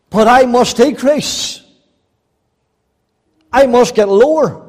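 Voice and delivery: loud, ringing pulpit delivery; fervent sermon voice; full-force preaching. Acted. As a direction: preach it out to the whole hall. Preacher voicing John the Baptist.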